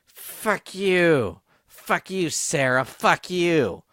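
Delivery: screamed, high and loud; aunt yelling. Retold or imitated. Imitating the aunt screaming at Sarah down the phone. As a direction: shriek it at her like a furious aunt. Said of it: "just goes".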